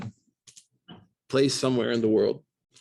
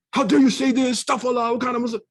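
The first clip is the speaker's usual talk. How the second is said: high, loud scandalized scolding; mock outraged commenter; 14 semitones higher, 5 dB louder than usual. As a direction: ham up the self-righteous commenter. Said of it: "I guarantee the comments".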